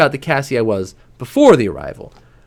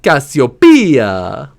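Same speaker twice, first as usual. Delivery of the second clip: slow, drawn-out, louder hammed-up Italian accent; bad Italian accent; 7 dB louder, much slower than usual. Teasing father calls his daughter, bad Italian accent. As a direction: stretch the name in a corny Italian accent. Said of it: "always in a very bad Italian accent".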